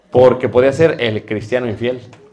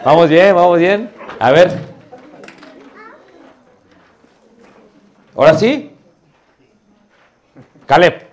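loud announcing; 5 semitones higher, 5 dB louder than usual. Real, not acted. Teacher calling class loudly.

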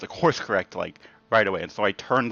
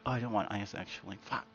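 much softer, muttered to oneself; muttered regret; 2 semitones lower, 13 dB quieter than usual. Retold or imitated. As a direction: grumble it under your breath. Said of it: "walk away and be like".

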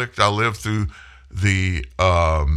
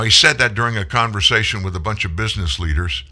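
normal talking voice

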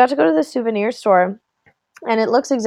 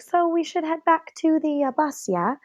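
higher, soft imitated nudge; tentative suggestion; 8 semitones higher, 6 dB quieter, slower than usual. Wife nudges group back to the bus, retold tentatively. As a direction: softly float it, seeking agreement.

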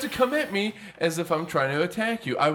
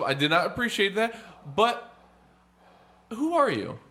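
ordinary talk, nothing marked

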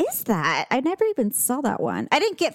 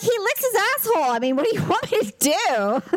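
higher, fast incredulous defence; exasperated; 10 semitones higher than usual. Retold or imitated. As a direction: throw up your hands at the vet. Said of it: "I'm like".

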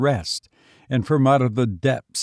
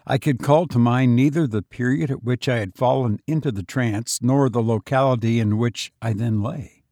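usual speaking voice

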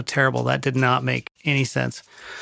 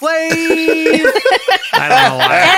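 laughing squeal; 6 dB louder, much slower than usual. Acted. Hype intro, laughing high squeal.

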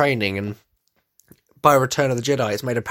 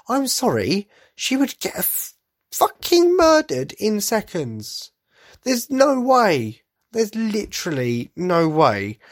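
exasperated; 8 semitones higher, slower than usual. Real, not acted. Ranting that Rey would lose.

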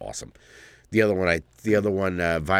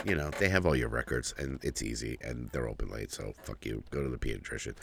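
much softer, low, dropping mumble; muttered retort; 11 semitones lower, 12 dB quieter than usual. Retold or imitated. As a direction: mumble it off dismissively. Dad's retort trailing to mumble.

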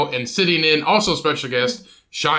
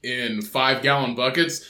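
usual speaking voice

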